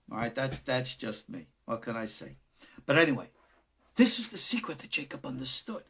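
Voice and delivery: ordinary talk, nothing marked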